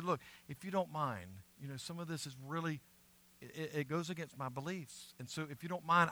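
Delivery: polite soft request. Retold or imitated. Daniel asks the chief official, a soft polite plea. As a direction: ask the official's permission politely and quietly. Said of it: "He said".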